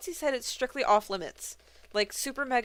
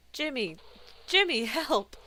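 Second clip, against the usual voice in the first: urgent call for help, higher and louder; 5 semitones higher, 4 dB louder, slower than usual. Acted. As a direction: shout his name in a panic. Crewmate calling for help.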